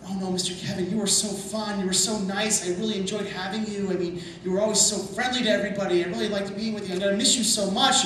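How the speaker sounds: hammed-up, about-to-cry gushing; imitating tearful goodbye. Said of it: "she's really starting to cry".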